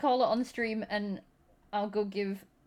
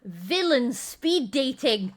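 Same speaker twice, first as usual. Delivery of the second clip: dramatic slow announcement; 4 semitones higher, 5 dB louder, much slower than usual. Real, not acted. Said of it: "announcing stream theme".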